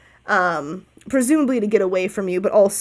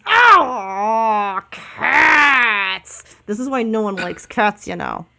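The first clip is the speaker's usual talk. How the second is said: dude-voiced bird; 2 semitones higher than usual. Bird to the cats pestering it at the carnival, aggrieved dude voice. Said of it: "I have to do a dude voice".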